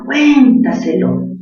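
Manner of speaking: "Cuéntaselo" said slowly, stress on cuen; slow stressed word. Shown as a demonstration. Teacher repeats "Cuéntaselo" slowly for students. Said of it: "Última sílaba".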